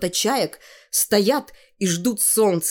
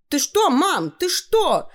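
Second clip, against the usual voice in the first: put-on high, startled girl's voice; high-pitched dismayed protest; alarmed protest; 10 semitones higher, 4 dB louder, slower than usual. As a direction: cry out to mother in rising alarm. Girl protests as mother locks her up.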